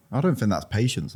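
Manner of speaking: normal talking voice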